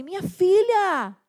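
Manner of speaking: loud, high, drawn-out alarmed call; protesting outcry. Retold or imitated. Mother retelling how she stopped the cartoon, alarmed. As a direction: cry out to your child in protest. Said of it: "Aí eu protestei".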